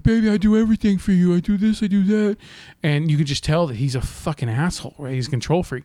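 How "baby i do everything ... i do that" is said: put-on higher, faster pleading boyfriend voice; whiny boyfriend. Controlling boyfriend defending himself to girlfriend, high whiny plea. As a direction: plead it quickly, pitched high. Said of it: "And he's like".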